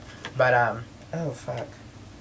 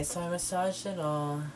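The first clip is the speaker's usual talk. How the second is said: hands pressed on mouth; muffled by fingertips; speaking through fingers; 4 semitones higher, 3 dB quieter than usual. Real, not acted. Seen 0: Fingertips cover the lips while massaging the face during the words.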